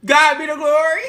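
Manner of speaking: high, loud joyful praise; rejoicing out loud, a little churchy. Real, not acted. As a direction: lift it up like a church shout. Celebrating two years home.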